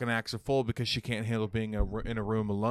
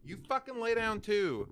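high-pitched order to a pet; command to dog; 12 semitones higher, 3 dB louder than usual. Real, not acted. Ordering the dogs to lie down.

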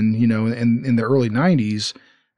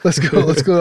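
louder, higher cheer; excited cheer; 4 semitones higher, 4 dB louder than usual. Real, not acted. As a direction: celebrate the win out loud. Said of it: "All right, then I win".

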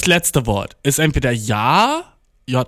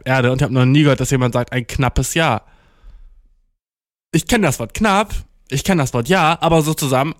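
usual speaking voice

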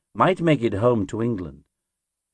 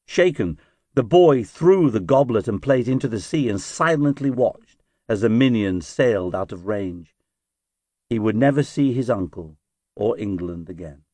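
usual speaking voice

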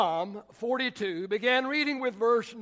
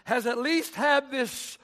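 higher, rising sermon cadence; preaching at full force; 3 semitones higher, 3 dB louder than usual. Acted. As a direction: press the point home to the church.

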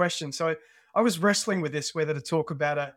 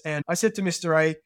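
ordinary delivery

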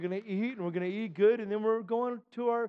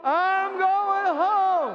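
high, very slow ringing call; preached refrain; 8 semitones higher, 4 dB louder, much slower than usual. Real, not acted. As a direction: hold each word out in defiance. Refrain repeated with congregation.

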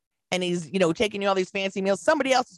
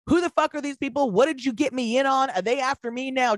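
higher, pitched-up mock alarm; faked panic; 6 semitones higher than usual. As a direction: play the scared innocent girlfriend. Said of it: "the best acting job of my life".

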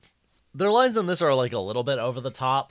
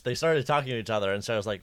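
usual speaking voice

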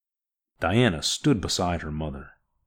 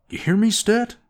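much higher, louder demand; demanding shout; 7 dB louder than usual. Acted. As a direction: shout the question into the comm. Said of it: "demanded Orn".

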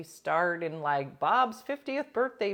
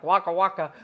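mock comic shtick; 2 semitones higher, 9 dB louder than usual. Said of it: "Obviously I wasn't like".

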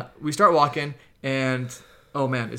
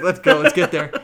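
louder, laughing hurry-up; urgent pep talk; 2 semitones higher, 8 dB louder than usual. Retold or imitated. Power walking home in a hurry, laughing urgency. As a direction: push yourself onward through laughter. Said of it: "Yeah, I was like".

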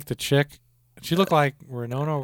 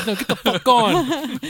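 mock angry girlfriend; 11 semitones higher, slower than usual. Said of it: "That's what you did".

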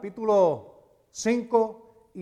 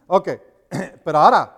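ordinary delivery

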